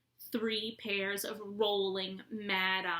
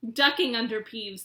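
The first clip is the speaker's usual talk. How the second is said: ordinary delivery, nothing marked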